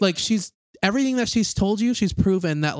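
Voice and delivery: pleading her case with rising frustration; heated rant. Real, not acted. Ranting at the movie character.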